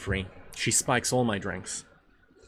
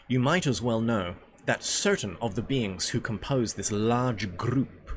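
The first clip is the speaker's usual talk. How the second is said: usual speaking voice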